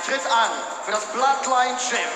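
normal talking voice

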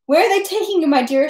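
anxious woman. A narrator's reading. Pockmarked woman asks arrested Pierre, lightly voiced anxious questioning.

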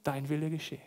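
leise, gedämpft nachgespielte Ergebung; weinend ergeben, nacherzählt. Retold or imitated. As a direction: sag es leise und ergeben, ohne echtes Weinen. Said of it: "Er weint und sagt". (leise nachgespielte Trauer, gottergeben)

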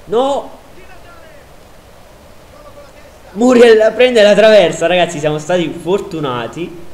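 loud high outburst; relieved shout. Real, not acted. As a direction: yell at the near miss. Shot hits the crossbar. (urlo di sollievo)

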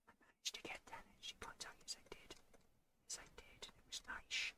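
very quiet voiceless whisper; hushed, barely-there whisper; whispered aside. Pretend. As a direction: whisper it to yourself. Whispered aside about the game's points.

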